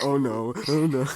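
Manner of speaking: loud, high hammed-up panic; mock-panicked wail, pushed loud; retold panicked crying. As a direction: re-enact freaking out, volume jumping up. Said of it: "I'm freaking out crying".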